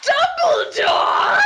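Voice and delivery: high, slow hammed-up cry of the name; dramatic shout. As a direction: announce him like a shocking twist. Dramatic reveal in fanfic reading.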